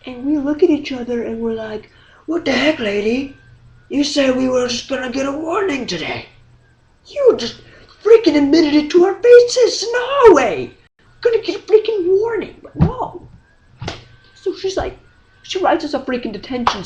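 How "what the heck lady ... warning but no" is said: high-pitched, building mock outrage; indignant protest. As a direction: protest in rising disbelief at the teacher's betrayal. Students protest the teacher's broken promise.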